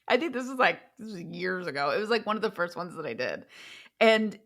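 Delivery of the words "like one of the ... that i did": normal talking voice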